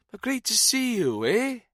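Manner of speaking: high, loud Welsh cheer; lilting Welsh accent. A narrator's reading. Jones toasts his old friend in a Welsh accent. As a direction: warm tipsy Welshman. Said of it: "Jones's diction was lilting and slow".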